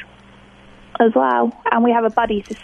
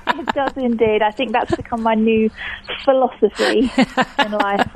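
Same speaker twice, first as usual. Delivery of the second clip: usual speaking voice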